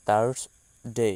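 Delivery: থার্সডে drawn out very slowly, repeated; careful model pronunciation. Shown as a demonstration. Teacher repeating থার্সডে slowly for students. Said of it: "সেটার ইংরেজি উচ্চারণ হবে এমন".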